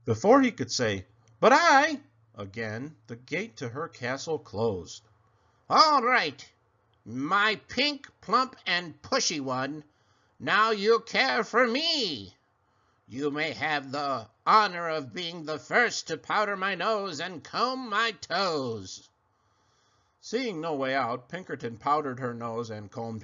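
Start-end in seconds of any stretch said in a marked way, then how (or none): 1.4-2.0 startled protest. Acted. Pig protests. 5.7-19.1 cackling creature. Acted. Creature commands.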